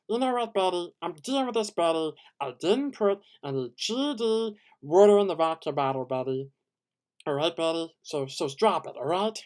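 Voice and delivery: loud, high, cocky snapping at Betty; full of himself. Retold or imitated. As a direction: bark at Betty, full of yourself. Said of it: "the more full of himself kind of way".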